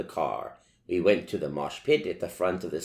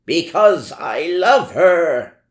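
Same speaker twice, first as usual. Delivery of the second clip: much higher, loud slow shriek; 10 semitones higher, 12 dB louder, slower than usual. A narrator's reading. Draco defending his love to the teachers, shrieking. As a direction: shriek it out desperately. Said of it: "Draco shrieked".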